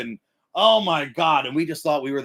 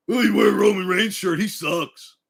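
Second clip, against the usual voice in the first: mocking, higher-pitched put-down; acted hater jeer; 6 semitones higher, 3 dB louder than usual. Hater mocking a Roman Reigns shirt, higher and jeering. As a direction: play a sneering hater.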